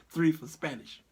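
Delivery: ordinary talk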